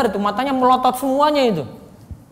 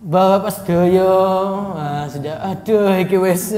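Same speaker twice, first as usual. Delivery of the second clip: low, slow, dragging drone; feeble dreary preacher; 5 semitones lower, slower than usual. Shown as a demonstration. Teacher mimics a preacher putting the congregation to sleep, dreary drone. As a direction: trudge through it wearily. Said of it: "suaranya pelan".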